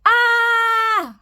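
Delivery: long high loud howl; troll howling as the river sweeps him away. Acted. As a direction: howl as you fall. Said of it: "howled the troll".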